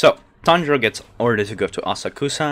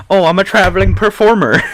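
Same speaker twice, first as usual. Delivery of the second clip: higher, louder mock stage announcement; hammed-up showman; 8 semitones higher, 7 dB louder than usual. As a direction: play the cheesy street performer. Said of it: "Tanjiro's like".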